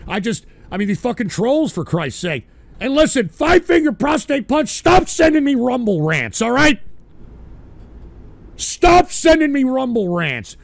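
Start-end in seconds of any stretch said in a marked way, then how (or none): 2.8-10.6 louder, higher angry telling-off; angry scolding. Real, not acted. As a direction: lay into the troll. Scolding troll for rants.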